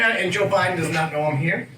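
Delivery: ordinary talk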